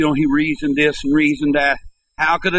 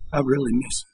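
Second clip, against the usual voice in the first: voice breaking; 2 semitones lower, 4 dB quieter, faster than usual. A narrator's reading. Preacher quoting Mister Templeton, voice mildly breaking. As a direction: read it quietly, as if choked up. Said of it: "and his voice broke".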